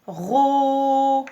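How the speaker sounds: "Ro" with a very long, loud "o"; syllabe étirée. Shown as a demonstration. Teacher sounds out first syllable. (« Ro » fort, le « o » très étiré)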